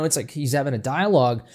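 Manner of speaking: usual speaking voice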